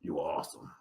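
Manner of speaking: low, soft, clipped praise; quiet low two-word compliment; low terse compliment. Retold or imitated. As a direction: say it flatly and walk off.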